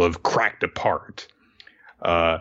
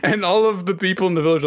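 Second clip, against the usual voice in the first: laughing; 10 semitones higher than usual. Real, not acted. Laughing retelling goofy kick.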